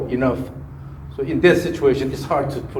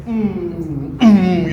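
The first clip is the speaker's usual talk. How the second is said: effortful swing hum; 3 semitones higher than usual. Shown as a demonstration. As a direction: hum the backswing into a burst. Coach sounds out backswing effort.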